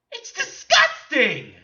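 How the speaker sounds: loud, high, slow revulsion; appalled outburst; disgusted. Real, not acted. Disgusted reaction to described costume.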